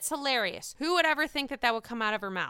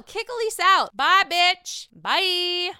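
higher, louder sassy kiss-off; sassy dismissal; 7 semitones higher, 7 dB louder, slower than usual. Real, not acted. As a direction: wave her off the show. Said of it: "dismissing guest".

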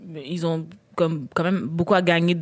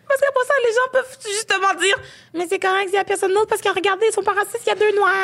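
much higher mocking quote of critics; 12 semitones higher, faster than usual. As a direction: parody the smug excuse, high and quick. Mocking what people will say.

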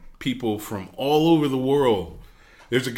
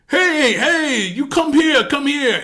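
high, loud hail; retold insistent shout across a distance; imitated calling over; 6 dB louder, slower than usual. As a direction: holler to summon someone, hammed-up. Tom calls him over from afar.

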